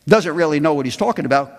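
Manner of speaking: ordinary talk, nothing marked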